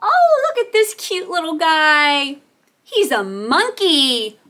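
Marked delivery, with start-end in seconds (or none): none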